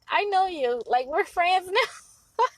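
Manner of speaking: high, giggly and slowed down; mock-chummy, laughing through it; laughing playful reply. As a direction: tease him like an old friend. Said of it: "And it's like".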